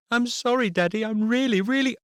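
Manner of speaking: put-on high, loud child's voice begging; high pleading child; pleading little girl. A narrator's reading. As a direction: beg Daddy like a guilty child.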